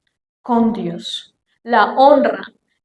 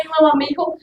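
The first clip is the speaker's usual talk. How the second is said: usual speaking voice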